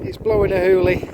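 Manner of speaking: loud, pushing over the gale; shouting over wind. Real, not acted. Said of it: "Sorry about the wind noise".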